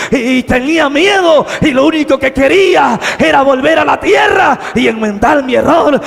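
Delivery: loud, high preacher's cry; full-force preaching. Acted. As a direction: preach it out to the whole church.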